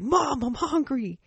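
put-on high, slow, drawn-out child's whine; whiny hungry kid.

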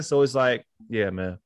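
ordinary talk, nothing marked

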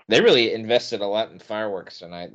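normal talking voice

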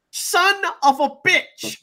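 high, slow drawn-out cry of disbelief; stunned outburst. Real, not acted. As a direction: let it out in shock. Excited wrestling call.

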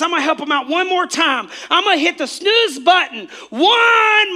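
high, loud whining pleas; whiny excuse-maker. Retold or imitated. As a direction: whine out each excuse.

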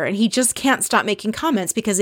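normal talking voice

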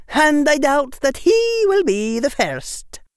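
high, firm schoolmistress; stern witch, final word. Acted. McGonagall firm.

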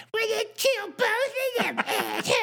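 hammed-up Starscream threatening both of them. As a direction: screech it like a cartoon villain. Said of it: "Starscream says".

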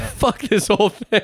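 high, drawn-out fed-up cry through laughter; mock outrage. Joking about quitting over banned menthols, laughing mock outrage.

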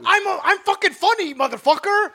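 mock outrage. Told no bits, protests.

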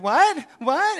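very high, incredulous squeal; mock shocked cry. Jennifer rejected at judgment, high mock screaming disbelief. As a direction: imitate a girl shrieking in disbelief. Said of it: "And she starts screaming".

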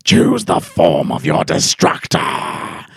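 very slow, deep, booming pronouncement; goddess voice. Retold or imitated. The goddess commanding them to choose a destructor, eerie. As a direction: stretch each word out, low and ominous. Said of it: "And she goes".

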